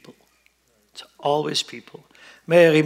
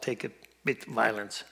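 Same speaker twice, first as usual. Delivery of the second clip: ordinary talk, nothing marked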